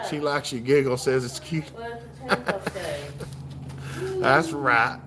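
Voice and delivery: ordinary talk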